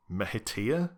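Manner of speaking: "Mehitia" tried out with a rising, questioning end; tentative "Mehitia", pitch lifting on the last syllables; unsure pronunciation attempt. Shown as a demonstration. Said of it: "not sure how that's meant to be pronounced".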